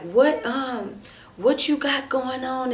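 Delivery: mildly indignant, drawing the question out; retold confrontation. Woman catching boyfriend on the phone, drawn-out angry challenge.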